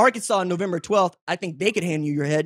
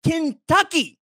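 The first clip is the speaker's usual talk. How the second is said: excited reveal; 8 semitones higher, much slower than usual. Real, not acted. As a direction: announce it like a game-show reveal. Reveal answer after quiz pause.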